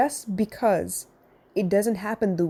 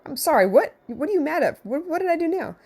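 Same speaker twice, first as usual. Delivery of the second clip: higher and rising, acting caught off guard and put out; mock defensive; 8 semitones higher than usual. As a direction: play the defensive parent who thinks he did nothing. Daughter says she is mad.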